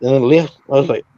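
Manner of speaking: normal talking voice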